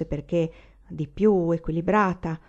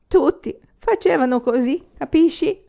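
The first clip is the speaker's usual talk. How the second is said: put-on drunk lieutenant; 9 semitones higher, 7 dB louder than usual.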